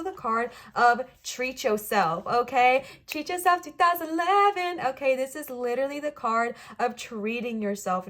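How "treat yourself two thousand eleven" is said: high, loud hammed-up catchphrase; playful shouted slogan; meme catchphrase. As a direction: belt it like a meme.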